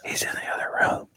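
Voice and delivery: fast urgent whisper; whispering caller. Acted. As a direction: hiss it fast so no one hears.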